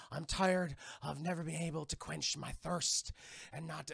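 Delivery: hushed, weary villain monologue; Barbossa impression. Pirate laments his endless hunger, subdued menace. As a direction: play the cursed captain, subdued and brooding.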